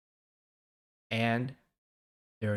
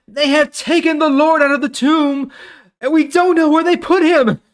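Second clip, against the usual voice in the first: much higher and louder, a frantic woman's plea; distressed Mary; 10 dB louder than usual. Acted. Mary telling Peter the body is gone, frantic and high. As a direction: cry it out like a panicked woman. Mary's panicked report, Bible reading.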